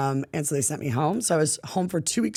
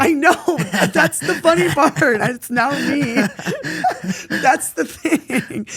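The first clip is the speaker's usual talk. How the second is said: laughing; 9 semitones higher, 5 dB louder, slower than usual. Real, not acted. Laughs at her own transformation.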